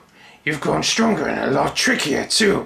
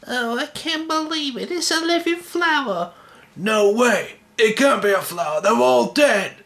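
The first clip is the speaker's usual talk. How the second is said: much higher, breathless wonder; amazed young voice; 9 semitones higher than usual. Acted. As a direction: marvel in a small high voice. Young character amazed by flower.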